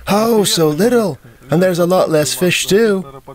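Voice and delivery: high, slow mock dismay at the number; retold as the local man, pitched high and slowed; surprised dismay. Local man reacts to bird count.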